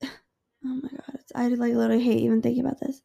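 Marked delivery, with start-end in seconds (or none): 0.0-1.3 whispered oh my God; dread whisper. Real, not acted. Hating to recall the night, whispered. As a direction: breathe it out in dismay.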